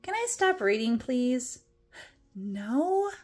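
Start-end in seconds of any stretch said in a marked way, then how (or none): none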